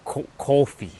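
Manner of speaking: Brooklyn coffee, rounded vowel; Brooklyn accent. Retold or imitated. Trying Brooklyn coffee for chat in a Brooklyn accent. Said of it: "a Brooklyn person say".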